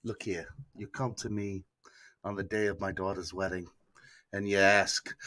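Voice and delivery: low, soft Italian mobster don; hammed-up Italian Godfather, deep and hushed; mobster Italian accent. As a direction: murmur it like an Italian mob boss. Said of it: "my mobster Italian accent".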